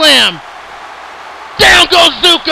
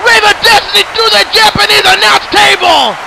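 commentator's shout; 4 semitones higher than usual. Real, not acted. Commentator calls Rave of Destiny through the table, shouting. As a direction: scream it as the table breaks. Table smash in wrestling match.

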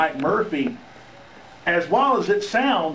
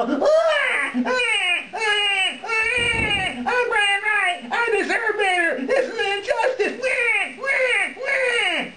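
much higher, hammed-up whiny fake sobbing; mock crybaby. Manager imitating Brian Wright's tantrum as a whining crybaby. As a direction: wail it like a spoiled kid in a tantrum. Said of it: "start crying and go".

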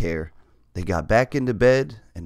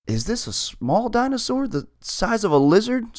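hopeful fear; 12 semitones higher than usual. Acted. Hopeful scared question.

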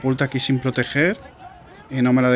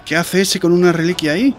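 exclamación alta y aguda de sorpresa; sorprendido; 6 semitones higher, 7 dB louder than usual. Real, not acted. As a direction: gritarlo al ver algo inesperado. Reacción sorprendida en partida. (sorpresa a gritos)

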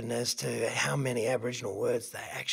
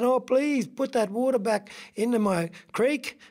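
higher-pitched begging voice; pleading eel; 8 semitones higher than usual. Acted. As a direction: beg for the water back. Eel begs in story.

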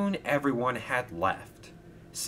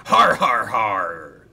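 pirate laugh; 10 dB louder, slower than usual. A narrator's reading. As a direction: cackle like a gleeful pirate. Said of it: "chuckled Sailor John".

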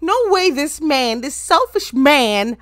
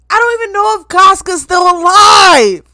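distraught shout; 5 semitones higher, 9 dB louder than usual. Real, not acted. Fan mourning that everyone in the show died, distraught. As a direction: wail it, stretching the last word. Wailing over the characters' deaths.

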